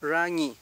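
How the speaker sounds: RAÑÍ with untrilled, English-like r; correct Mapuche r. Shown as a demonstration. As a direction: model RAÑÍ, neither rolled nor tapped. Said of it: "No es".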